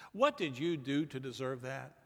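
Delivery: ordinary talk, nothing marked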